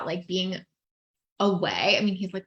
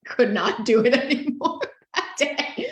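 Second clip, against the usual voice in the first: higher, louder, breaking up with real laughter; crying laughing; 4 semitones higher, 5 dB louder than usual. Real, not acted. Wife recalling her overwhelmed husband, crying laughing. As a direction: laugh helplessly through the words. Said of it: "I'm, like, crying, laughing".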